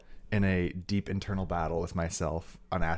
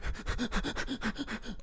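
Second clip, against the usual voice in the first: breathless high giggle; 5 dB quieter than usual. Real, not acted. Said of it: "You're basically hyperventilating over there".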